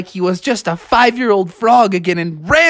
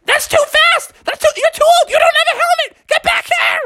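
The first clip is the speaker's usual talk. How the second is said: high-pitched alarmed nagging; shrieky mock scolding; acted frantic scolding. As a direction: holler after a reckless old frog. Beatrice yells after speeding Froggy.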